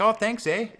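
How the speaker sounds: high, loud Canadian border guard; hammed-up cheery Canadian accent. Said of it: "have to have the right voice".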